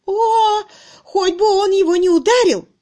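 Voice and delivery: very high, loud, fearful cry; frightened wail. A narrator's reading. Miss Twitterton fearing for the servant, wailing. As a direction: wail in dread of violence. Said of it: "приняла особенно близко к сердцу".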